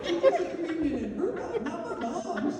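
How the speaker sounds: high, loud comic disbelief; astonished. Real, not acted. Storyteller to audience on sister taking mom's communion, astonished. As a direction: hammer the punchline high and incredulous.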